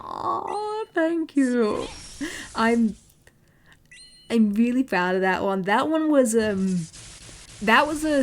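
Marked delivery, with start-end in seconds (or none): none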